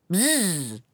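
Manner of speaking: long buzzing "bzzz"; buzzing creature noise; lizard's buzz. A narrator's reading. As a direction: imitate a lizard with a drawn-out buzz. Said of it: "fit le lézard".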